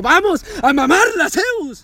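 high, loud mock victory yell; shouting at the screen as the crowd celebrates; retold cheering shout. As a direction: belt it like a fan in the stands. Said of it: "Tranquilo, no grites".

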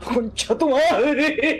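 high, very drawn-out panicked curse; laughing panic. Real, not acted. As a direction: wail the swear word slowly, cracking up. Creature crawls toward the players.

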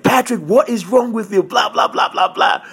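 high-pitched scolding, trailing into blahs; mock enraged coach. Coach berating wrestler over weight, mock enraged. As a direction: imitate an outraged coach's rant without shouting. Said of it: "He was so enraged".

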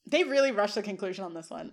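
ordinary talk

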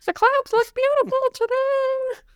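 high, airy giraffe voice; dreamy giraffe up in the clouds. Pretend. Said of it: "that's my giraffe voice".

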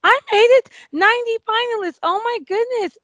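high, thrilled shriek of joy; excited scream. Retold or imitated. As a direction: squeal it like you just won. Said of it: "I was screaming".

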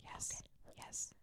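barely audible whisper; whispered encouragement. Real, not acted. Cheering on the flustered co-host before the heavy part, whispered. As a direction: whisper a little pep talk.

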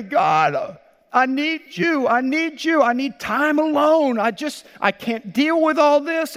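high, loud, desperate mock prayer; overwhelmed plea. Pastor acts out the prayer of an overwhelmed believer, high and loud plea.

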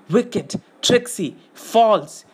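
high, bitter creature outburst; spitting accusation. A narrator's reading. Gollum turning on his master, spitting with anger. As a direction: spit out each accusing word separately. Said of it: "He spat".

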